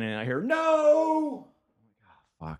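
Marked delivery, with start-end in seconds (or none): 0.4-1.5 high, drawn-out mock yell; drunk heckler's yell. Drunk Australian heckler yells at the comic, high mock yell. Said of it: "he's talking again".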